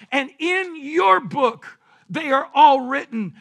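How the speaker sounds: slow, loud, weighty pulpit reading; scripture proclamation. Real, not acted. Preacher reading God's word to the congregation, slow proclamation. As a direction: declaim the psalm with drawn-out emphasis. Declaims a psalm at full force.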